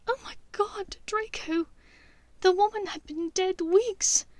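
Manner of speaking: put-on frightened young witch pleading; Hermione voice. A narrator's reading. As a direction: voice Hermione distressed and urgent.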